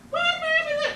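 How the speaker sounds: put-on kids in disbelief. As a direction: squeal it back as if it can't be true.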